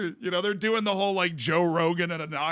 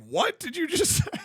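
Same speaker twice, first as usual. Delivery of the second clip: baffled listener; 12 semitones higher than usual. Retold or imitated. Listener reacts to the call.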